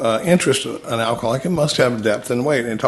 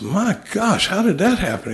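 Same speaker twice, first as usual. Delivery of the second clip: amazed; 8 semitones higher than usual. Retold or imitated. Amazed man in the joke.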